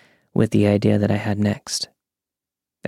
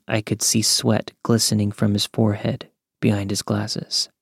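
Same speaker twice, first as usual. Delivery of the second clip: plain reading voice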